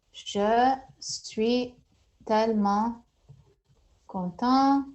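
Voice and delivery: Je suis tellement content broken into slow chunks; word by word. Shown as a demonstration. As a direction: split Je suis tellement content into separate words. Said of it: "I'm very happy".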